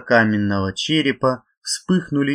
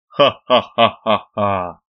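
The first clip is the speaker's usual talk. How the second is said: slow booming demonic laugh; sinister laugh; 3 dB louder, slower than usual. A narrator's reading. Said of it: "раздался зловещий смех".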